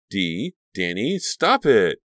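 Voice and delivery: drawn-out names, then loud high cry; startled cry. A narrator's reading. Caroline finding her friends fighting, startled cry. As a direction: call out in alarm, rising sharply. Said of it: "Caroline's startled cries".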